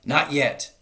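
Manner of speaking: loud high shout. A narrator's reading. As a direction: shout back. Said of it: "shouted Bogus".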